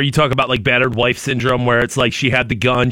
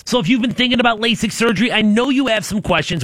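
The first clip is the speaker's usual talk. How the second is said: ad read; 5 semitones higher than usual. Acted. Host reads a radio ad.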